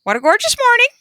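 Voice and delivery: high squawky parrot voice; high squawking parrot yawn. A narrator's reading. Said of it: "a squawking voice yawn".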